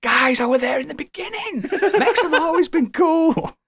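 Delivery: high-pitched, tearful fan protest; hammed-up wail of a long-time fan; mock whining. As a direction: whine it high, half laughing, stretching the end. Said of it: "I'm like".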